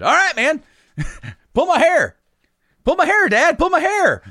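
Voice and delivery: put-on high, loud excited little kid pleading; squeaky kid begging his dad to go ahead; eager kid voice. Kid begging dad in the store to pull his hair, squeaky and eager.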